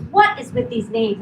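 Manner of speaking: a husband's hammed-up disbelief; exasperated outburst. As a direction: throw up your hands at the names. Husband reacting to film trailer.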